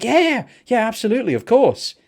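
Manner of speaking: high-pitched imitation of a keen yes; eager agreement. Musician agreeing to a fan's picture request, imitated eagerly. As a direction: retell it as an effusive, willing yes. Retold star agrees to a photo.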